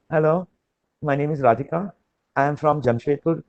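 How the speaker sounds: soft, shy self-introduction; timid introduction. Shown as a demonstration. Trainer demos a weak candidate greeting the interviewer, timidly. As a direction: mumble your name like a nervous candidate. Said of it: "give it your best shot".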